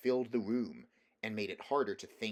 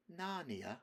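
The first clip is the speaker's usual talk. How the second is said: soft and sweet, higher; sweet quiet voice; 7 semitones higher, 5 dB quieter than usual. A narrator's reading. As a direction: coax gently, a soft lilt. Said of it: "speaking in a sweet, quiet voice".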